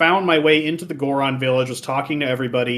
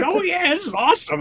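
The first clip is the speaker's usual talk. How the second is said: much higher, delighted; dancing chief. Retold or imitated. Goron chief dancing to the song, high delighted voice. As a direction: squeal with joy while dancing. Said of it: "it's like".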